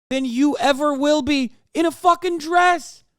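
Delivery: usual speaking voice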